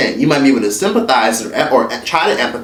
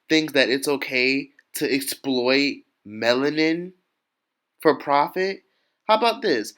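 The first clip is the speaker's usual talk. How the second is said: mock; 8 dB quieter than usual. Mocking Ashley's mindset, much softer.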